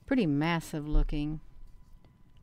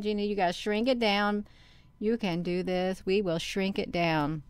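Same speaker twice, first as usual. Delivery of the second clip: normal talking voice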